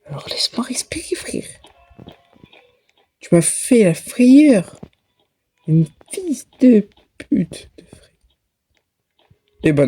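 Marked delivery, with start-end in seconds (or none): 0.0-1.5 breathless unvoiced whisper. Real, not acted. Catching breath after scare. 3.2-4.8 loud high slow shaken complaint. Real, not acted. After scare. 5.7-8.1 slow unvoiced cursing whisper. Real, not acted. Cursing after scare.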